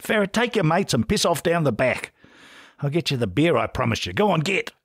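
mild shout. A narrator's reading. Publican sends Ferret out the back, mildly shouting. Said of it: "he shouted".

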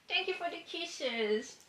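slow, soft, high sing-song pet voice. Real, not acted. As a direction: stretch it out sweetly for the puppy. Cooing to her dog Franklin.

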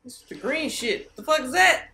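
normal talking voice

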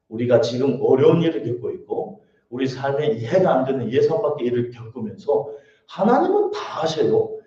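ordinary talk, nothing marked

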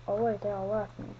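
ordinary talk, nothing marked

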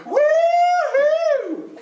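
very high, long falsetto whoop; full-on woo-hoo. Shown as a demonstration. Leader shows how it's gotta be, full-on high woo-hoo. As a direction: commit fully to a high squeal. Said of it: "It's gotta be".

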